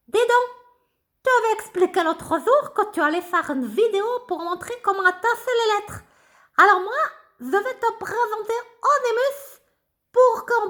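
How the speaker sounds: high, eager little puppet voice; a chirpy puppet sidekick chatting to the teacher; high excited puppet voice. Acted. Ratoun puppet talking.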